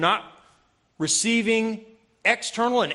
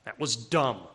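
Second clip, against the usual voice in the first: sober self-rebuke; 8 semitones lower, 3 dB quieter than usual. Retold or imitated. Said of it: "Act like a man and take it".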